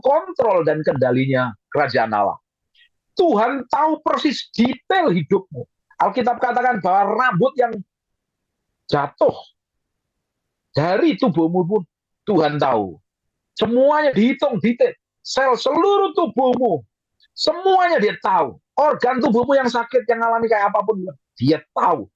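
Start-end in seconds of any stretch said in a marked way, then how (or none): 3.1-13.0 high, forceful preacher's voice; preaching at full force. Real, not acted. 13.5-22.1 high, forceful preacher's voice; preaching at full force. Real, not acted.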